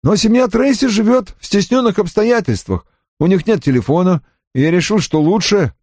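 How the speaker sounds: high-pitched, aggrieved excuse; offended. A narrator's reading. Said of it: "произнёс он обиженным тоном". (обиженным тоном)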